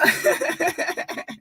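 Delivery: very high wheezing laugh; real laughter. Real, not acted. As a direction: dissolve into high cackles. Cannot stop laughing at clip.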